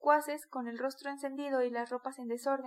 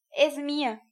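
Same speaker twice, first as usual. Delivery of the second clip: louder, possessive bark; angry shout; 2 semitones higher, 9 dB louder, slower than usual. A narrator's reading. Micolca shouting over his mare, angry bark. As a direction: bark it out as a claim. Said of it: "gritó Micolca".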